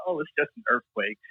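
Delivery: softly calm, unbothered wife. Retold or imitated. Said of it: "And she said it so calm".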